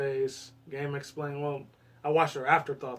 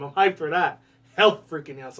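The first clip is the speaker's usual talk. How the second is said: louder hyped-up cheer; 7 dB louder than usual. Real, not acted. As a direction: get pumped about it. Excited about announced game.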